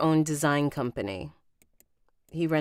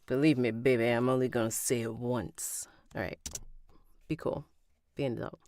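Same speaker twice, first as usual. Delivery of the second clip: low, controlled warning; lightly voiced man laying down the law; stern man; 3 semitones lower, faster than usual. Man telling his partner only once, low and firm.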